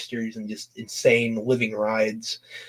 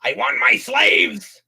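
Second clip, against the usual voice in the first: high, drawn-out cartoon villain; mock villain demand; 9 semitones higher, much slower than usual. Fan riffing on a possessed villain, high slow mock demand. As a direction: stretch each word in a high-pitched silly demand.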